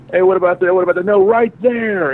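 mock Darren spotting a restaurant; imitating Darren. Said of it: "he'll go".

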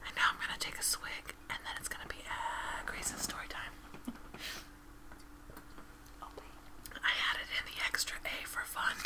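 breathy whispered ASMR read. Acted. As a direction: whisper softly into the mic. ASMR Corner whisper segment.

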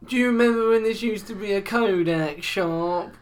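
slow, high, stretched-out oddball voice; weird old customer. Acted. Odd customer reminiscing to shop staff, weird slow voice. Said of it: "these weird pauses as well".

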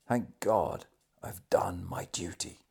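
hushed last words, sinking into a whisper; whispered thanks of a dying man. A narrator's reading. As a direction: let the voice fade away to breath.

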